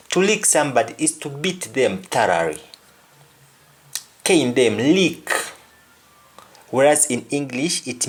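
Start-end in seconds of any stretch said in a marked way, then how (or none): none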